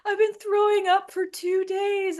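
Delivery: high, whimpering complaint; whiny sister. Retold or imitated. Sister complaining of two days throwing up, high whining. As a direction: mimic a miserable little sister.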